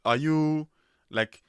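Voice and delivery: usual speaking voice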